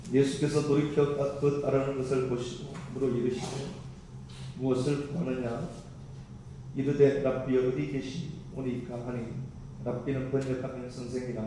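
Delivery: loud, measured reading of the Bible passage; formal responsive Bible reading; scripture reading. Acted. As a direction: proclaim the verses to the congregation. Reads scripture aloud responsively.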